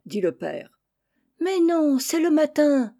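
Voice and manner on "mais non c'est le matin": much higher, louder playful whine; chicks' singsong protest. Acted. Chicks refuse bedtime, high singsong protest. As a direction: chant the protest like little kids. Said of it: "chantaient tous les oisillons".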